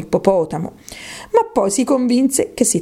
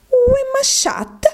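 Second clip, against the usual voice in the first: very high, slow French question; drawn-out squeaky textbook question; Alice trying French; slower than usual. Acted. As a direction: pronounce a schoolbook French phrase carefully, pitched way up. Alice tries French on the mouse.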